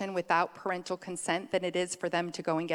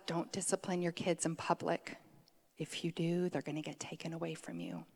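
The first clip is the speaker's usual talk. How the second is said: hushed warning; 3 semitones lower, 11 dB quieter than usual. Retold or imitated. Quoting the era's warning, hushed.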